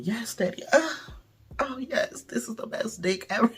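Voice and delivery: high, breathy, whispered fake ecstasy; fake sex moaning. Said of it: "I'm faking".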